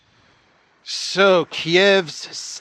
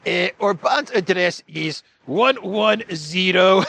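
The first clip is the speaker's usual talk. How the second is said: higher mock politician voice breaking into chuckles; hammed-up impression, amused at the joke; Zelensky impression; 4 semitones higher than usual. Pretending to give Orban's address to armed forces, mock impression. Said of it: "in my Zelensky voice".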